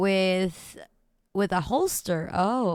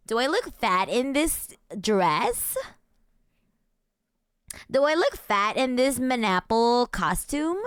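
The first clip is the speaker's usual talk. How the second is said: put-on lilting Valley Girl question, key words stretched; hammed-up Valley Girl, rising at the end; Valley Girl uptalk; 2 semitones lower than usual. Shown as a demonstration. Streamer inventing examples for chat, Valley Girl uptalk.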